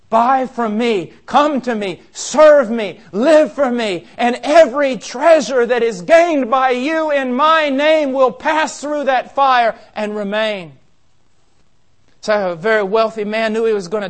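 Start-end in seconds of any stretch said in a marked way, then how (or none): 0.1-10.7 loud, slow pulpit call; full-force preaching. Acted. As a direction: proclaim every line like an altar call. Preacher voicing Christ's call.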